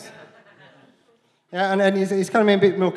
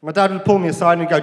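normal talking voice

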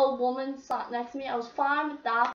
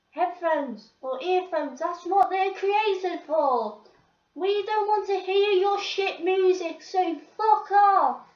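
building to a loud, higher telling-off; angry retort; 5 semitones higher, 5 dB louder than usual. Retold or imitated. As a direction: snap at the chavs blasting music. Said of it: "I'm quite angry".

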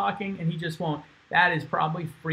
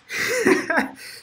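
high burst of laughter. Real, not acted. Laughs at his own story.

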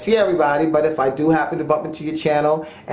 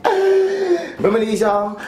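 high, slow gasp; gasping surprise; 9 semitones higher, much slower than usual. Acted. Gasps at old clip.

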